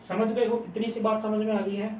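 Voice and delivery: ordinary talk, nothing marked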